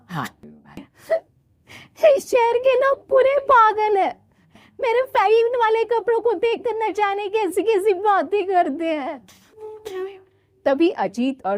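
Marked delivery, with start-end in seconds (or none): 2.1-9.2 very high whiny bride; tearful complaint. Acted. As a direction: complain through tears to husband. Soumya complains, crying. (रोते हुए, ऊँची आवाज़ में शिकायत)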